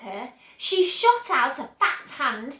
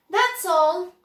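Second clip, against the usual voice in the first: proud; 6 semitones higher, 7 dB louder than usual. A narrator's reading. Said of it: "gazing proudly at the result".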